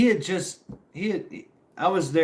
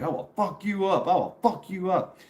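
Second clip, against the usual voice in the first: higher mock drunk tough guy; drunk threat; 6 semitones higher than usual. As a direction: play the drunk who wants a fight, pitched up.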